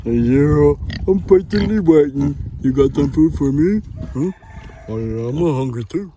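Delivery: a lightly voiced pig begging for food; voicing the pig. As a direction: speak for the hungry pig. Dad voices the pig Wilbur.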